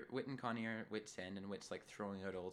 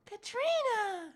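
very high, loud drawn-out shout; mock exasperated cry; 13 dB louder, much slower than usual. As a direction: shriek the name, stretched out. Acting out Connie's exclamation.

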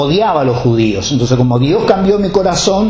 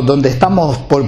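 ordinary talk

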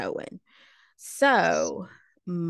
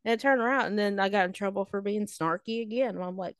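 ordinary talk, nothing marked

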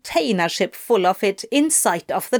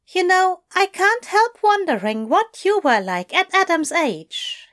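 higher, gentle musing voice for Aziraphale; Aziraphale wondering aloud; 10 semitones higher than usual. A narrator's reading. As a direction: muse fondly over a lover's past. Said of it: "he mused".

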